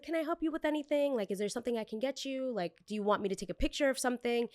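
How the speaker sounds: high overly polite server voice; sugary helpful waitress lilt. Pretend. A server offering help to a pushy guest, high and sweet. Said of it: "This is my server voice".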